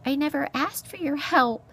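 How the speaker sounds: high, indignant little voice; exasperated mouse. A narrator's reading. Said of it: "an exasperated Poppy replied".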